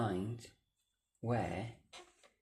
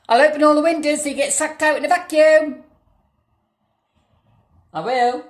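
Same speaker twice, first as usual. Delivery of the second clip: loud threat; 12 semitones higher, 10 dB louder than usual. Real, not acted. Threatening the ghost.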